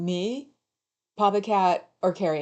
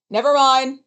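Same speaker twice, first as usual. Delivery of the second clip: loud dismissive call across the room; loud never mind; 6 semitones higher, 12 dB louder than usual. Real, not acted. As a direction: call it out and give up. Calls out to partner, gives up.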